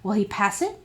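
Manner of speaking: plain reading voice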